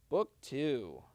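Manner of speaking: ordinary delivery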